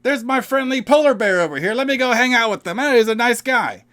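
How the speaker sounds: high, loud, dopey mock voice; naive goofy voice. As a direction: play a clueless guy cooing at a bear. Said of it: "Rupert's like".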